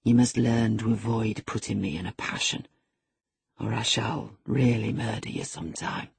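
low, controlled menace; forced calm over simmering anger; assumed calmness. A narrator's reading. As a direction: keep the threat low and measured. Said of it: "said with assumed calmness".

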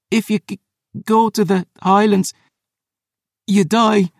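high, halting warning in pain; groaning. Acted. Poisoned Phoebe warns about the Highlands, groaning. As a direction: struggle to get the warning out. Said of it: "she groaned".

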